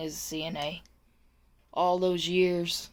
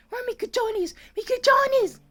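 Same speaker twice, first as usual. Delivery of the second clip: high-pitched mock yell, getting louder; imitated yelling; 4 dB louder than usual. Aide replays what she heard from the patient, high mock yell. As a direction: imitate her yelling in Spanish, very high and building. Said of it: "she was yelling at me".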